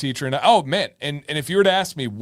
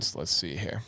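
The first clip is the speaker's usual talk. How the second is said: ordinary talk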